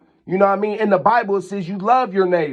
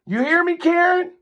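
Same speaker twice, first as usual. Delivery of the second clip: taunting call-out; 10 semitones higher than usual. Real, not acted. Taunting the neighbor directly.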